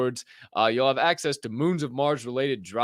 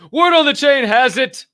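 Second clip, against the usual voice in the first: high, loud show catchphrase; loud, high-pitched announcement; segment call-out; 12 dB louder than usual. Acted. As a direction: belt out the segment title up high. Calls out a show segment title.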